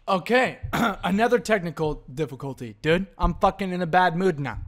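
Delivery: ordinary delivery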